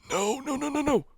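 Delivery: high, soft gasping no no no; panicked gasp. Real, not acted. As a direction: plead as the danger closes in. Game danger, panicked pleading.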